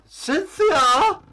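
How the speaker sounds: high, loud call across the castle. Acted. King calling his daughter Cynthia, loud and high. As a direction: summon her from far off.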